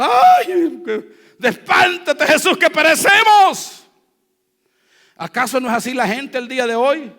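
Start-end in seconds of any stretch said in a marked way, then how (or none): 0.0-3.8 high, loud, terrified cry for help; panicked shouting. Acted. Disciples shouting to Jesus in a storm, terrified shout.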